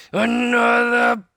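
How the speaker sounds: very loud, high, slow roar; pirate captain raging at another book; furious roar. A narrator's reading. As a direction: explode with anger. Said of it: "roared Captain Bones".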